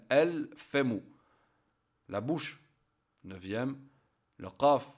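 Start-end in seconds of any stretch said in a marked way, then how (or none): 0.1-1.0 "al-famu" with a clearly sounded L; lam pronounced. Shown as a demonstration. Teacher showing learners the lam is always pronounced, clearly sounded L in "al-famu". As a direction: say "al-famu", keeping the L of the article audible. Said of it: "on prononce toujours le lam".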